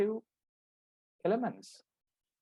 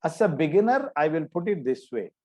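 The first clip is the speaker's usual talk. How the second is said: ordinary delivery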